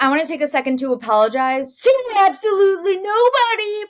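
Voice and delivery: high, mock-grand stage announcement. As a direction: parody a pop star's non-apology, pitch soaring. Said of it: "he was like".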